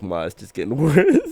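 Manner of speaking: loud, laughing mock wail; mock whining. Telling mom the fever is worse, mock wailing. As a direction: whine it, breaking into laughter. Retold complaint to mother about fever.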